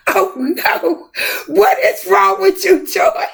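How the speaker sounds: high, startled scolding of the plant; alarmed at the plant. Retold or imitated. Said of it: "I said".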